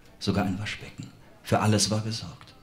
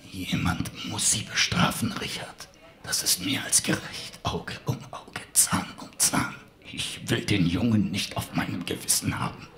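weak whispering villain; 5 dB quieter than usual. Acted. Dying villain whispers accusations.

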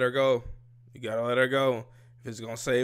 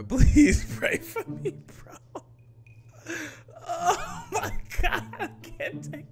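high, slow words squeezed out through laughter; can barely speak for laughing; 4 dB louder, slower than usual. Real, not acted. Reactor begging viewers between laughs, laughing hard. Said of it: "I'm laughing at myself".

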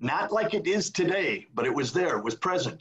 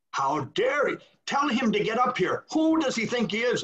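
outraged cardinal; 8 semitones higher than usual. Retold or imitated. A Vatican official furious at the resignation, shouting indignantly. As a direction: sputter with offended outrage. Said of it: "They went berserk".

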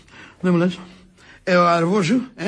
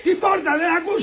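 high impatient outburst; irritated scolding; 7 semitones higher than usual. Acted. Master scolding maid for ignoring the door, irritated.

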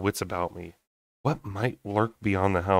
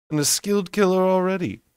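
high, sly and louder jab; mocking fae voice; 11 semitones higher, 3 dB louder than usual. A narrator's reading. Lucian jabbing at Fyra about her killing, sly and mocking. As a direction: needle her with a smirk.

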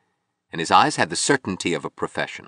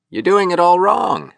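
put-on very high, loud drunken taunt; swaggering gaiety; 13 semitones higher, 6 dB louder than usual. A narrator's reading. Drunk sculptor lecturing his visitor, swaggering gaiety. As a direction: crow it tipsily, pitched way up. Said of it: "swaggering gaiety".

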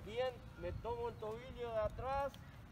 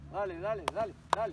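loud urging; 2 semitones lower, 7 dB louder, much faster than usual. Real, not acted. Coach urging through exercise set.

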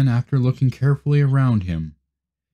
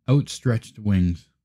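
ordinary delivery, nothing marked

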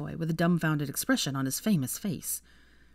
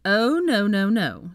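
loud, raised string of refusals; big pitched-up protest; emphatic refusal; 5 semitones higher, 6 dB louder, much slower than usual. A narrator's reading. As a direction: shut the idea down firmly. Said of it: "said Ginny, shaking her head".

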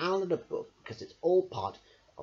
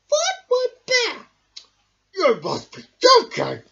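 loud, exasperated cartoon voice; squeaky indignant man; grumpy dump man; 13 dB louder than usual. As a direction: scoff in disbelief.

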